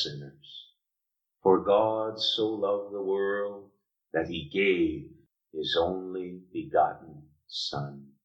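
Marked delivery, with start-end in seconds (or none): 1.4-8.1 slow, deliberate, weighing each word; preacher reads John 3:16 slowly to the congregation, slow and solemn; slow reading of the verse. Shown as a demonstration. Let the verse land slowly and solemnly. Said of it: "We say that so quickly".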